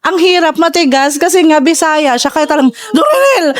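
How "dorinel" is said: sharp high call of the name; mother calling out. Retold or imitated. Mom yelling at her daughter, sharp high call. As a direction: yell her name like an angry Bisaya mom.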